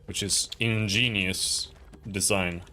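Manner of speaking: slow, drawn-out sarcasm; sarcastic. Real, not acted. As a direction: stretch INGENIOUS slowly in mock praise. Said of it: "that was sarcasm, by the way".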